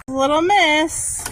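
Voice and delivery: high, drawn-out singsong call; calling the dog. Real, not acted. Owner calls to her little dog, high drawn-out coaxing.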